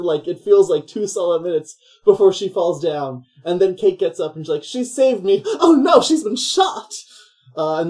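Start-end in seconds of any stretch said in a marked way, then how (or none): 4.6-6.9 much higher, louder, slowed melodramatic gasp; mock dismay. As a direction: overplay the shocked discovery. Kate discovering Meg shot.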